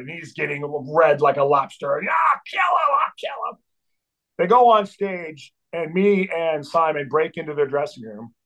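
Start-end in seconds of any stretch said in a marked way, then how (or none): 2.1-3.6 very high strangled yell; mock-raging threat. Red-faced singer raging behind a bodyguard, furious imitation. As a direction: imitate someone wildly raging while restrained.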